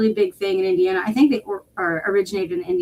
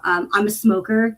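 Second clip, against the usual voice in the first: normal talking voice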